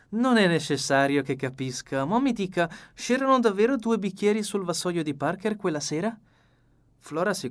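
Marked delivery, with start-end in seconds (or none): 0.1-6.2 higher, lively fussy detective; the dapper detective, pleased with his plan; Poirot voice. A narrator's reading. Poirot answers Flora.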